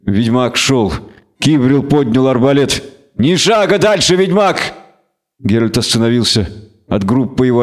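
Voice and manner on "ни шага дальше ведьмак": threatening shout. Acted. Civril aims crossbow, warns witcher.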